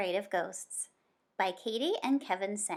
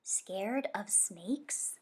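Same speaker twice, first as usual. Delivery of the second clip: slow, softer hushed question; lowered voice; 6 dB quieter, much slower than usual. Acted. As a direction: Zoe lowering her voice to ask about snakes. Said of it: "Zoe lowered her voice".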